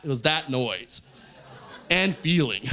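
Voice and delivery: ordinary talk, nothing marked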